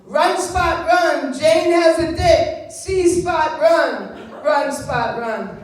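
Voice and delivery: loud, slow sing-song primer read; mock reading of a modern primer. Shown as a demonstration. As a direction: chant it like a kids' reader. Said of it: "what the books would say today".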